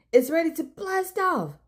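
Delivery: mild excited shout. Acted. Boy tells his new friend the rocket is ready, excitedly. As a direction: cheer it like a proud little builder. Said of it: "Kavir shouted".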